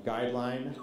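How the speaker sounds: ordinary delivery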